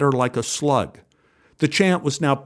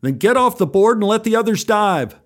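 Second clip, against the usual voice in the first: shouted order; 7 semitones higher than usual. Acted. Lifeguard shouting orders at boy.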